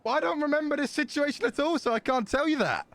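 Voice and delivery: high-pitched flustered denial. Acted. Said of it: "you're doing your flustered voice".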